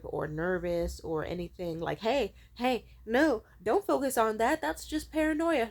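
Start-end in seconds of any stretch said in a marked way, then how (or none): 2.0-5.7 higher, insistent imitation of a dude; retold persuading, pitched up; mock pushy reassurance. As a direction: act out a guy brushing off worries. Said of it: "feels the need to tell someone".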